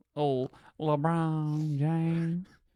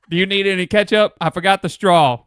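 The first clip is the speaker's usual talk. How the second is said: rude drive-thru worker; 5 semitones higher, 14 dB louder than usual. Acted. Worker roleplay.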